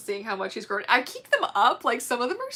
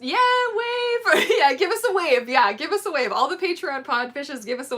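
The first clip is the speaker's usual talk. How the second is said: high, loud whoop easing as it goes; rally them with a loud, high cheer; excited cheering; 6 semitones higher, 6 dB louder than usual. Real, not acted. Streamer urging viewers to wave, excited cheering.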